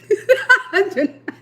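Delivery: squealing giggle; high giggle. Real, not acted. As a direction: burst of high laughter. Laughing at comments.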